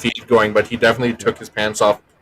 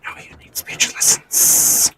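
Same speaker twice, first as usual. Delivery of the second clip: whispering; 11 semitones higher, slower than usual. Shown as a demonstration. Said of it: "I just enunciate".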